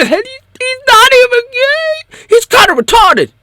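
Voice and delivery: very high wailing shrieks; shrieking crying voice. Retold or imitated. Critic loses it completely, screaming and crying high. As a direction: shriek it to the top of your voice.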